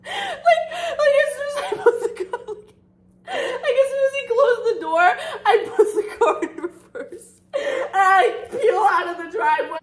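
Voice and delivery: words squeezed out high through laughing; can barely get it out for laughing; real helpless laughter. Real, not acted. Cracking up at her own story.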